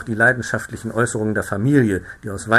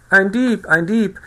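high, loud alarm scream; screaming woman; 9 semitones higher, 7 dB louder, slower than usual. A narrator's reading. The farmer's wife screams that there is a thief. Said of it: "die Frau schrie".

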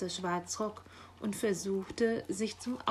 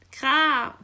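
loud, raised crow croak; raven's caw; 6 semitones higher, 12 dB louder than usual. A narrator's reading. Raven summoning the magpie downstairs, loud caw. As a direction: imitate a crow's loud caw. Raven caws while giving orders.

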